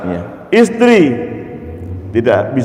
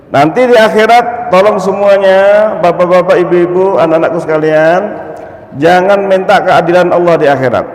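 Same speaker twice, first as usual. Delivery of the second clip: fervent preaching; 7 semitones higher, 3 dB louder than usual. Real, not acted. Preacher pleading to congregation.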